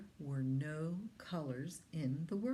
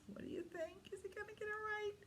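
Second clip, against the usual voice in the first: soft, high hushed question; suspense whisper; 13 semitones higher, 10 dB quieter, much faster than usual. Acted. Reader whispers to the child before the reveal. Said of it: "suspense whisper to child".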